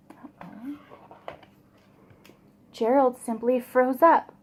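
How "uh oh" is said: hushed worried whisper. Real, not acted. Reader to children after Gerald is mocked, whispered. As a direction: breathe it out in a whisper.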